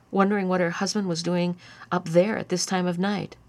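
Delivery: plain reading voice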